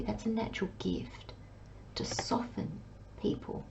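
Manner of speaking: hushed, confiding whisper; soft intimate whisper. Real, not acted. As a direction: breathe it like a secret. Soft emphasis on a reader's gift.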